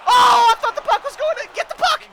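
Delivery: very high, loud frantic yell; high-pitched shouted outburst. Real, not acted. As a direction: yell in dismay, then urge them on. Near miss, urging players.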